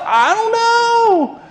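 slow, high, drawn-out mock answer; hammed-up clueless reply; blind man's reply. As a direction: stretch it out like a shrug.

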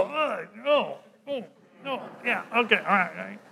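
soft, reluctant just-woken mumble; groggy morning groan. Retold or imitated. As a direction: drag yourself out of bed, grumbling quietly.

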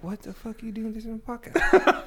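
high-pitched mock disbelief; incredulous, rising retold question; retold dismay. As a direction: act out being appalled at your mother, voice climbing. Retelling dismay at mom listening.

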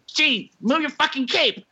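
high, slow, strained mock Peter voice; hammed-up whiny plea to move the cape; imitating Peter. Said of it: "And Peter's like".

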